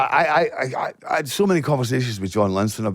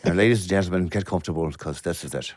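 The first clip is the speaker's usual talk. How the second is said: lower, softer Irish showman welcoming the crowd; Irish announcer voice; 5 semitones lower, 4 dB quieter than usual. Pretend. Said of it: "This is how he was greeting me".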